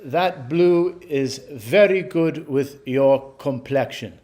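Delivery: slow, badly mimicked accent of the salesman Lionel; a clumsy go at Lionel's accent, drawn out. Vlogger imitating salesman Lionel's accent while retelling his compliment. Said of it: "my accent there is terrible".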